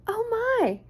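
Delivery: high, squeaky mock gasp; storyteller hamming up mock fright; mock surprise. A narrator's reading. Wizard of Oz echo.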